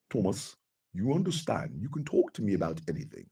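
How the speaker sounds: very soft, low imitation of a British friend; hushed, rough British-accent impersonation; Elijah's accent. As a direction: gently offer support in an attempted British accent. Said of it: "never be able to do your accent".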